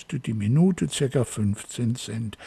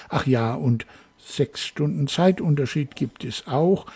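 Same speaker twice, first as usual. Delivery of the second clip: ordinary talk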